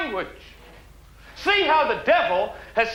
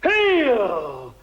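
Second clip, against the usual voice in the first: long falling cries, last one loudest; fervent healing shout; faith healer's command; 2 semitones higher, 3 dB louder, much slower than usual. Acted. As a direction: draw out each cry to cast out the demon.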